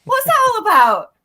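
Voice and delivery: high, loud and disbelieving; Droylsden, of all places?; surprised. Real, not acted. Host surprised by the comedian's Droylsden bit, high incredulous exclaim. Host reacts to odd tour topic.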